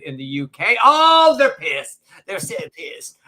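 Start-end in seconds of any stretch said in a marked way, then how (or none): 0.8-2.0 gleeful oh. Real, not acted. Gleeful reaction to outrage. 2.3-3.2 whispered aside. Real, not acted. Whispered aside about critics.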